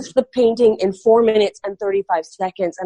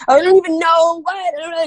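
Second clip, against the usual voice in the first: high, incredulous blurt; retold disbelief; 10 semitones higher, 4 dB louder than usual. Telling the interviewer how stunned she was by the tour offer. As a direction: replay her stunned reaction, much higher.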